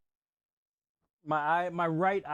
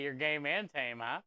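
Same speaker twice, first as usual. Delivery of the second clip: ordinary talk, nothing marked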